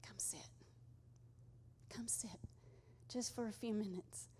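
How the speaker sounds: gentle whisper, very soft; whispered invitation. Acted. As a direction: breathe it as a hushed call. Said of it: "God is just saying".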